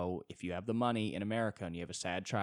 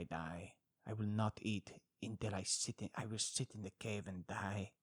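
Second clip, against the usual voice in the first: put-on Italian accent. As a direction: brood dramatically in an Italian accent. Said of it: "an Italian would also be like".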